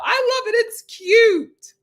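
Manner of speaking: squealed high and loud, delighted; cute, cute, cute!; gushing. Real, not acted. Gushing to the co-host about his accent, squealing.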